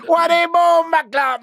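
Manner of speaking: very high, loud, slow shouted challenge; aggressive gang shout. Retold or imitated. Gang member shouting at him in the yard, loud slow shout. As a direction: bellow it across the yard. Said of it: "So he goes".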